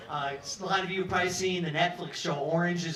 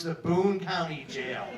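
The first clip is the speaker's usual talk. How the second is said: slow ominous words; slower than usual. Shown as a demonstration. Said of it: "first time I heard the words".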